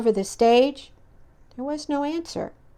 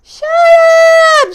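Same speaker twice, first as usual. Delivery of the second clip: screamed; 12 dB louder than usual. Acted. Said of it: "screamed Toad".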